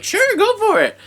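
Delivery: cheery puppet voice. Pretend.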